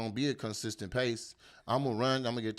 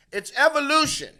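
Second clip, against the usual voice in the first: big booming proclamation, pitched way up; shouted declaration; 9 dB louder than usual. Acted. Comic announcing evolution to the crowd, shouted. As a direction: yell it like a revelation.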